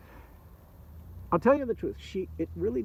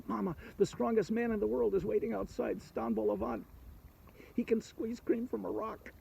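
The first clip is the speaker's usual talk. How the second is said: a lightly higher eager dragon voice; dragon to his mother; 5 semitones higher, 5 dB quieter than usual. Acted. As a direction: tell mama the news, a bit higher.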